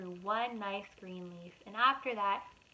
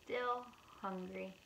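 ordinary delivery

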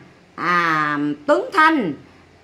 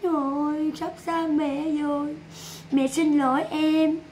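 mock tearful mother; 7 dB quieter than usual. Mother telling her son she is losing him, mock weepy. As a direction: imitate a mother's fake crying farewell, much softer. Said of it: "Lại nó mình nói thương con á".

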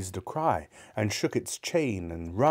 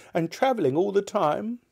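put-on high, questioning old miser; musing Scrooge; 12 semitones higher than usual. Scrooge asking about seven years' travel, musing. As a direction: wonder aloud, pitched high. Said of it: "mused Scrooge".

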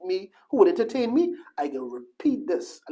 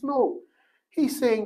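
ordinary talk

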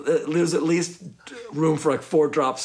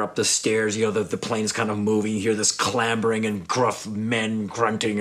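normal talking voice

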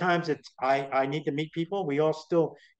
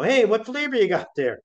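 friendly call; 7 semitones higher, 6 dB louder than usual. Retold or imitated. Chatting up a stranger.

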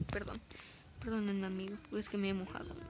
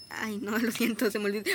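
ordinary talk

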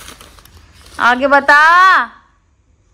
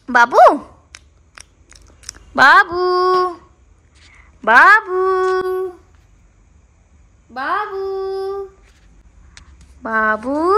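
drawn-out, louder calls of babu; calling sweetly; 5 semitones higher, 6 dB louder, much slower than usual. Real, not acted. Partner calling her sulking girlfriend by pet name, sweetly. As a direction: sing out babu to win her back.

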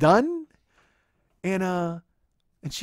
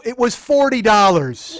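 loud, drawn-out, raised pitch; exasperated yell; 5 semitones higher, 10 dB louder than usual. Retold or imitated. Boyfriend defending the upgrade price to his girlfriend, slow exasperated yell. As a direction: belt it out slowly in exasperation.

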